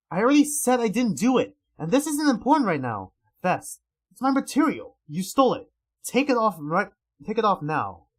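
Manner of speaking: angry demand. Acted. Arguing over the stolen vest.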